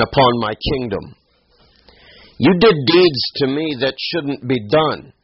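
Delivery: high-pitched, accusing questions; indignant king. Acted. Abimelech confronts Abraham over the sin brought on him, indignant. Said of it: "Abimelech called Abraham and said to him".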